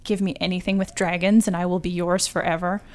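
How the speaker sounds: ordinary delivery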